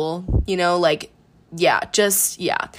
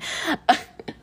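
breathy stifled giggle; 9 semitones higher than usual. Real, not acted. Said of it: "I'm trying not to laugh into the mic".

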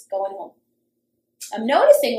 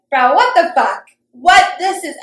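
loud, higher-pitched retold confrontation; indignant outburst; 4 semitones higher, 10 dB louder than usual. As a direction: imitate yourself blowing up in disbelief. Acted angry confrontation on his return.

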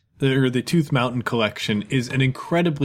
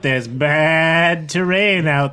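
friendly rental clerk, a bit higher; goat folk vendor; 4 semitones higher than usual. Acted. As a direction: greet the travelers like an eager salesman.

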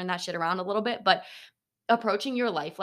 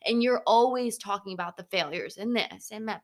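ordinary delivery

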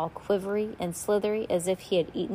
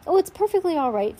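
wincing reassurance; 10 semitones higher, 6 dB louder than usual. A narrator's reading. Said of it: "just wincing in pain".

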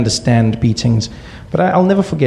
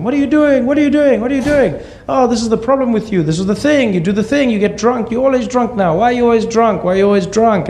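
high-pitched, rapid-fire scolding; mom shouting; 11 semitones higher than usual. Retold or imitated. Mother berating her drunk husband, mock shouting. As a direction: imitate an outraged mother, pitched way up. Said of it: "she started to shout at him".

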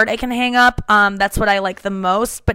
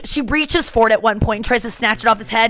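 normal talking voice

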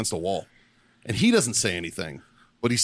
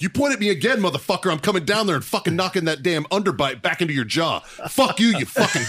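reenacting the yelling at him; shouting at the swimmer, a reenacted rage; retold tirade; 6 semitones higher than usual. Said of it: "I go off on this motherfucker".

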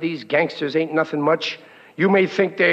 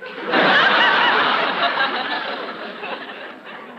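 laugh. Acted. [name] laughs at own joke.

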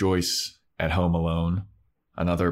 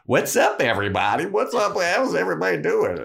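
much higher, louder bubbly greeting; cheery Cordelia; 10 semitones higher, 3 dB louder than usual. Retold or imitated. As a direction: breeze in oblivious and chipper. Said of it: "she's like".

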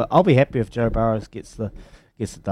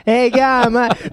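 high-pitched, hammed-up Australian greeting; badly faked Australian accent, pitched way up; attempted Australian accent; 13 semitones higher, 5 dB louder, much slower than usual. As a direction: have a jokey go at an Australian accent. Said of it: "I can't do an Australian accent".

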